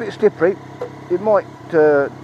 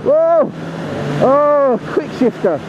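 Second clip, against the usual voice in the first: high, thrilled cries of discovery; excited whoops; 10 semitones higher, much slower than usual. Real, not acted. Rider finds the bike has a quickshifter, excited whoops. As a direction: whoop in real delight, pitch jumping up.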